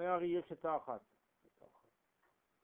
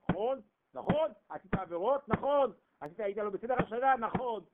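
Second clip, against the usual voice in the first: louder, higher fervent sermon climax; preaching at full force; 5 semitones higher, 6 dB louder than usual. Acted. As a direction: build the crowd up with each line.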